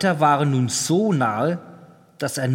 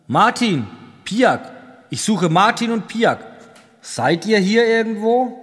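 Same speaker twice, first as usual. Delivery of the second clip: higher, louder searching call; 6 semitones higher, 4 dB louder than usual. A narrator's reading. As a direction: call for missing companions. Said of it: "rief er". (laut suchend gerufen)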